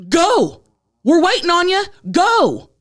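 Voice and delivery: high, loud, impatient commands; acting out losing your temper; re-enacted screaming. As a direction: yell to hurry someone along. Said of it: "then I start screaming".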